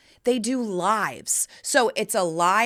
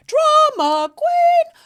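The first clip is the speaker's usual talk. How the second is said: drama queen; 7 dB louder, much slower than usual. Acted.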